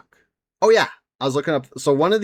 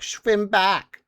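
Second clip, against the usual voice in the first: attempted Spanish accent that comes out German, pitched higher; badly faked Spanish inquisitor, sounding German; botched Spanish accent; 7 semitones higher than usual. Inquisitor ordering the dumped prisoner to swim, botched Spanish-German accent. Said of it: "that's not a Spanish accent. That's German".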